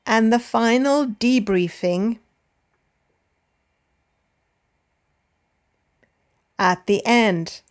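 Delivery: measured listen-and-repeat reading; dictation read. Shown as a demonstration. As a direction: read it out in short chunks, pausing for repetition. Slow dictation read with long pauses.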